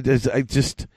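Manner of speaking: ordinary talk, nothing marked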